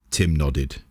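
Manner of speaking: ordinary delivery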